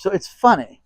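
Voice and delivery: ordinary talk, nothing marked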